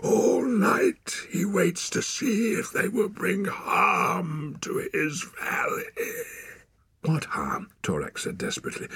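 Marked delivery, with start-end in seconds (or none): 0.0-5.8 high, slow, menacing wild man; wild man ranting. Acted. Wild man rants about valley. 7.0-7.7 frightened whisper. Acted. Torak frightened.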